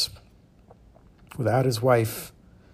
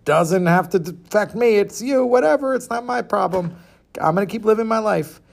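lightly voiced horse, higher pitched; dismissive horse; 7 semitones higher, 3 dB louder than usual. Horse brushes off the mouse, lightly voiced and dismissive. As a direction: shrug off the problem as the horse, pitched up. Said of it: "the horse is like".

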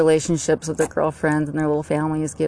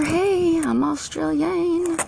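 high, drawn-out put-on Australian accent; mock Australian accent; 13 semitones higher, much slower than usual. Shown as a demonstration. Showing how tongues shape accents, mock Australian accent. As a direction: pitch it way up in a playful Australian accent. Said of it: "gives that special hint that".

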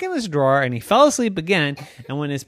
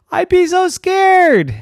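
scared; 8 dB louder, slower than usual. Acted.

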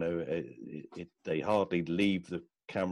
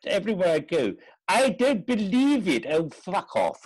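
high, loud exasperated rant; hammed-up outrage, stretching the words to a peak; Richard Wilson; 11 semitones higher, 5 dB louder than usual. Friend mimics the actor fed up with fans, exasperated. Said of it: "He said".

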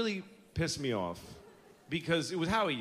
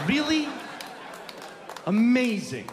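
mocking disbelief; 6 semitones higher than usual. Mocking amazement.

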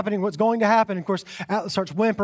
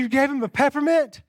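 incredulous scold; 7 semitones higher than usual. Retold or imitated.

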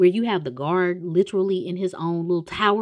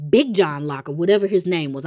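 ordinary talk, nothing marked

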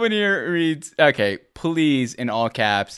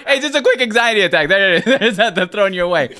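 laughing; 7 semitones higher, 7 dB louder than usual. Real, not acted. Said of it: "laughing at anxiety joke".

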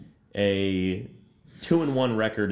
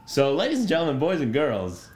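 announcer voice; 5 semitones higher than usual. Acted. Segment announcement.

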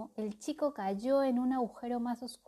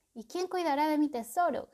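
moaning; 6 semitones higher, 5 dB louder than usual. Acted. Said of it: "gemió el cuervo".

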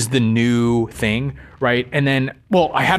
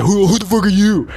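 higher-pitched mock outrage; indignant mock challenge; 8 semitones higher, 4 dB louder than usual. Sceptics answering the speaker's big claim, mock indignant. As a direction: imitate a scornful doubter cutting someone down. Voices critics' hostile challenge.

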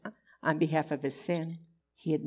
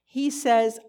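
ordinary talk, nothing marked

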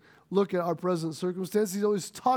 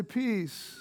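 much softer, gentle lead-in for the crowd; soft prompt; 8 dB quieter than usual. Shown as a demonstration. Pastor leading the congregation to repeat, softly. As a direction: breathe it out softly for them to echo. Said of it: "Everybody say".

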